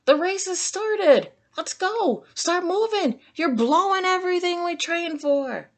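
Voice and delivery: very high, pushy coaching; desperate coach begging his snail to go; frantic urging. Acted. SpongeBob pleading with Gary to start moving, frantic and high.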